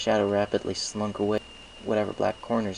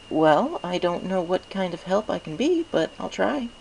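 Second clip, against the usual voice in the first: put-on higher, earnest young mare; hesitant but willing; Twilight Sparkle uncertain; 10 semitones higher than usual. A narrator's reading. Twilight voiced.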